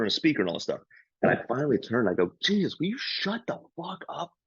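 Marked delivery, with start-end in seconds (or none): 2.4-4.3 high, exasperated yell at the crowd; retold angry yell. Comic telling the noisy table to shut up, in a high exasperated yell. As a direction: snap at the noisy table, pitch way up. Angry outburst at loud hecklers.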